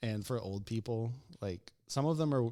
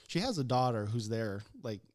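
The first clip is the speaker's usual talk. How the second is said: normal talking voice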